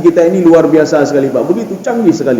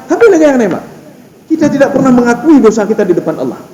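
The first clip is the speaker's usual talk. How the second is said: usual speaking voice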